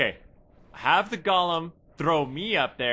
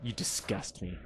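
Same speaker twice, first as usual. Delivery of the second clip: disgusted; 7 semitones lower, 14 dB quieter than usual. Retold or imitated. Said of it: "I'm just like".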